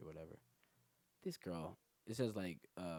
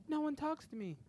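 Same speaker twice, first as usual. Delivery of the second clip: put-on much higher timid girl; mock girl voice; 5 dB louder than usual. The shy new girl answering him, high and timid. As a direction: squeak it shyly.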